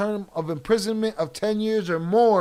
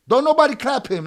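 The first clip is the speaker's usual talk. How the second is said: heated command; 7 semitones higher, 9 dB louder than usual. Real, not acted. Streamer berating chat viewer.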